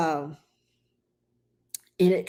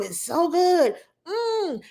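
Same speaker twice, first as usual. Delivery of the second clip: high, savouring rave with a hum; gushing praise; 9 semitones higher, slower than usual. Retold or imitated. Friend talking up her homemade dressing, gushing praise. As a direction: imitate a friend raving, pitched way up.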